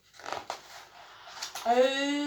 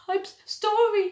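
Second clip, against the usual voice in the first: muttered apology; 8 semitones higher, 3 dB louder than usual. Acted. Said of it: "he muttered".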